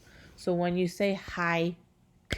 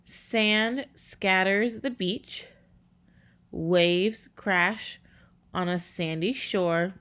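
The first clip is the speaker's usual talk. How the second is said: slow, measured haiku reading; reading a haiku slowly; slower than usual. Shown as a demonstration. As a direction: let each syllable of the poem land. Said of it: "they just broke it down here".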